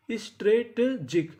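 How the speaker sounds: slow, sounded-out "strategic", piece by piece; broken into syllables. Shown as a demonstration. Teacher sounds out a new word for learners, syllable by syllable. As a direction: draw out "strategic" with gaps between the syllables.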